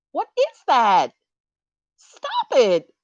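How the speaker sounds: slow, emphatic high scold; Janet. Acted. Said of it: "Janet, is basically saying".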